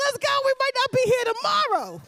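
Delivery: high, excited rallying yell; hyped-up shout. Retold or imitated. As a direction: yell it like there's no tomorrow. Acting out live-like-no-tomorrow hype.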